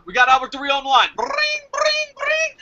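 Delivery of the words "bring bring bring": very high-pitched phone trill; imitated phone ring. Pretend call to Albert Del Rio, imitated ringing.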